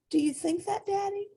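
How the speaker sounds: put-on high childlike voice; little rabbit. A narrator's reading. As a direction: speak up brightly like a small child. Little Rabbit asks Daddy.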